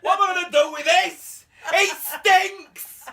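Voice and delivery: high, loud squeal of disgust; hammed-up outrage at the smell. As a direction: shriek it like he's covered in sick. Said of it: "and he went".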